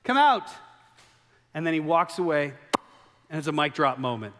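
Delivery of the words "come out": high, loud, firm command; commanding call. Acted. Jesus commanding Lazarus out before a mic drop, high and loud. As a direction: call him out and walk off. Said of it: "it's kind of like Jesus is".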